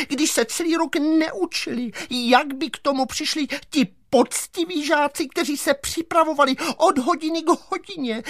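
very high-pitched protesting teacher voice; shrieking teacher. A narrator's reading. Teacher objects to top marks for the giants, shrieking. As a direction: cry out in high, drawn indignation. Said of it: "soudružka učitelka začala křičet".

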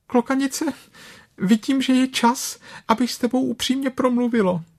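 very high little voice trying to sound brave; as bravely as possible. Acted. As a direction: puff up a tiny voice with courage. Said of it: "řeklo co nejstatečněji". (co nejstatečněji, vysokým hláskem)

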